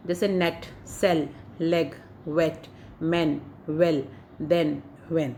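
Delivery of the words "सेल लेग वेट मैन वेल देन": सेल, लेग, वेट, मैन said slowly with a short ए; clear e sound. Shown as a demonstration. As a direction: demonstrate the ए vowel carefully. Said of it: "इसमें ए बोला था". (ए की ध्वनि धीरे से)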